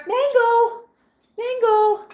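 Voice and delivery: high singsong name call; high, coaxing pet call. Real, not acted. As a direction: call the bird over in a high voice. Calling the pet bird by name.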